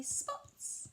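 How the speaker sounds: high soft sing-song look; modelling for a child. Shown as a demonstration. Parent showing a toddler the page, soft and sing-song. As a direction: point and coo at the picture for a toddler. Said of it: "point to the spots and say".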